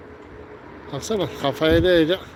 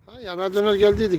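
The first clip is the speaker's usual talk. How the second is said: normal talking voice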